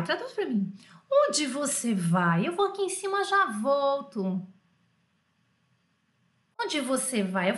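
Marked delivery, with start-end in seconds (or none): 1.1-4.5 very drawn-out question and answer; slow dictation. Shown as a demonstration. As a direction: read it out slowly for translating. Teacher repeats exercise sentence slowly.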